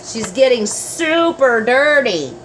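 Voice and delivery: usual speaking voice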